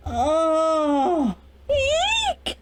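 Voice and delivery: high delighted eek; excited squeal. Real, not acted. Reader squealing over a fun chapter, excited. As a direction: squeal with real glee at the chapter. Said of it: "it's such a fun chapter".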